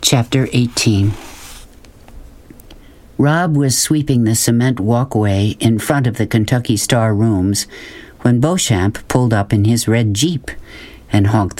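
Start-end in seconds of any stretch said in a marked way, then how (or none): none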